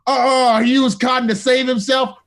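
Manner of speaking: high, loud hyped-up outburst; loud delighted cinema cheer; excited cheer. Retold or imitated. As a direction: shout at the screen in delight. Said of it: "one dude even was like".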